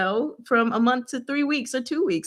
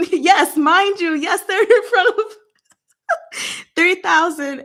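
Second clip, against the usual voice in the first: laughing; 9 semitones higher, 5 dB louder than usual. Real, not acted. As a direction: talk through giggles. Laughing while explaining scene.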